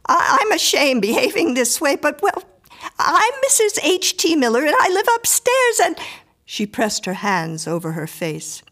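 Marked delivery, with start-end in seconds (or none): 0.0-6.0 high, loud, faltering and flustered; acted agitated outburst; distressed cry. A narrator's reading. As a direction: stammer out an urgent apology, pitched high. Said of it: "she cried".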